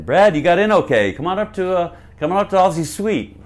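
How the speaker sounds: imitated road manager calling someone up; Larry McNeely. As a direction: call out a hearty invitation down the phone. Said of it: "phone call from Larry McNeely, the road manager".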